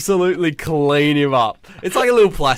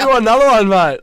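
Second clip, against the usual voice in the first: mock teacher challenge; 10 semitones higher, 9 dB louder than usual. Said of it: "He's like".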